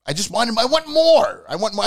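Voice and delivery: high, pleading fan outburst; real high-pitched exasperated plea; frustrated demand. Real, not acted. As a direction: voice jumps up, demanding it. Vents frustration, demanding more.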